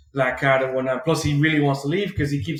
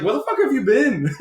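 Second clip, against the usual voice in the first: high-pitched, laughing mock girlfriend voice; playfully mimicking MJ, much higher and laughing; 10 semitones higher than usual. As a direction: squeak it out high while laughing. Girlfriend voiced in retold scene.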